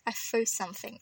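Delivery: "flew something" with F for TH. Shown as a demonstration. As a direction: say "flew" with F instead of TH. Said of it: "I say it with an F instead".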